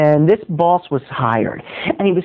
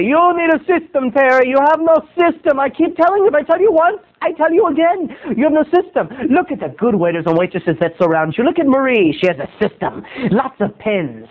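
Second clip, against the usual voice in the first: high, lecturing boss voice; mock prissy boss lecturing the waiter; snooty boss; 7 semitones higher than usual. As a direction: scold him like a spoiled boss. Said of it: "And he'd say".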